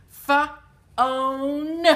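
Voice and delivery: "Fa-own" split into f and a long own; sounding out. Shown as a demonstration. Teacher showing the F sound in phone, "Fa-own" in two pieces. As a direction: separate "Fa" from a drawn-out "own". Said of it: "This P H says the F sound".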